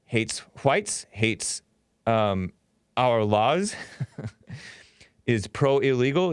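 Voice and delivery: normal talking voice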